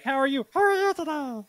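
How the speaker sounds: very high, chirpy little creature voice; high squeaky penis voice. Pretend. Host voicing his talking penis, very high and squeaky. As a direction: squeak it up like a tiny talking toy. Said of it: "I'd be like".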